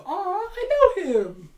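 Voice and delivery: high, slow, sugary Xavier; mock tender recognition. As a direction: coo it like seeing an old friend. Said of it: "Xavier gets a moment of".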